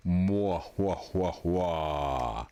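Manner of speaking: voiced sad trombone, slow wah-wah. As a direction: mimic a falling trombone fail. Sound effect punchline again.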